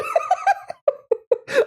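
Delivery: very high giggling fit; squealing laughter at your own silly voice. Real, not acted.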